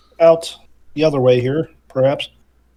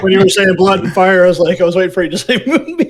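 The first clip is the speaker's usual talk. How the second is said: laughing; 4 semitones higher, faster than usual. Real, not acted. Laughing explanation.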